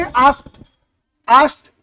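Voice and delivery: "आस्क्ड" said as "askt" with a crisp final t, lightly rising; correct pronunciation. Shown as a demonstration. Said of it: "ऐसे बोलोगे आप". (सही उच्चारण "आस्क्ड" धीरे से)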